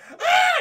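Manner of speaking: sudden high shriek of pain; shrill hammed-up scream of pain. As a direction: yelp like you just took a stone in the eye. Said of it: "Just starts screaming".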